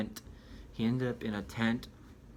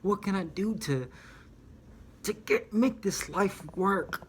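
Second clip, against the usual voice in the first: desperate plea; 5 semitones higher than usual. Retold or imitated. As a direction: beg God, voice climbing higher and stretching out. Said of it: "he's like".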